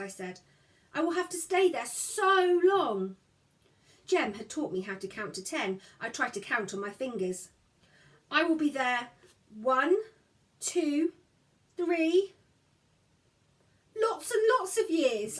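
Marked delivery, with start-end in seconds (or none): 0.9-3.2 higher, slow lament; a small girl's woeful wail, lightly voiced; sad child. A narrator's reading. Girl grieving to her brother, slow and sad. 8.3-15.4 higher, counting dolefully. A narrator's reading. Girl counting years to her brother, doleful. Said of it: "I said dolefully".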